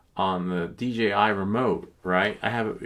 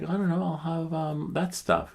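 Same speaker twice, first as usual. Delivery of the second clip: high unsure self; 10 semitones higher than usual. Retold or imitated. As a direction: drag out the clueless order. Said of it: "I was like".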